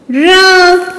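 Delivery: र with the vowel hummed through the nose; nasalized vowel. Shown as a demonstration. Teacher showing children chandrabindu, nasalized र. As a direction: draw out र as a nasal रँ. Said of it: "र पर लगेगी तो".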